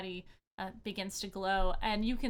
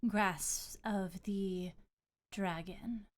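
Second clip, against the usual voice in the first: gracious goddess. Acted. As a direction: speak as a serene water goddess. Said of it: "and she says".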